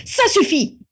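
loud, high-pitched outburst; furious yell. A narrator's reading. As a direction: explode in fury. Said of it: "Hurla Cygnus en frappant du poing sur la table".

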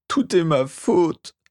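high, slow anguished wail; sobbing. A narrator's reading. Master blaming himself, high slow sobbing. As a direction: draw it out in acted sobs. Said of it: "sanglota Régulus".

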